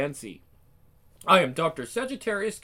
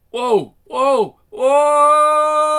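rising louder and higher yells; yelling whoa; 8 semitones higher, 12 dB louder, much slower than usual. Acted. Ash falling through time, yelling louder and higher. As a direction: scream it longer each time.

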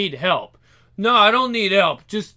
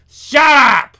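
loud high shout; shouted snap; 6 semitones higher, 10 dB louder than usual. Acted. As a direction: cut them off with a yell. Sudden outburst.

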